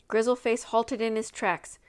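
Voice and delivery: ordinary delivery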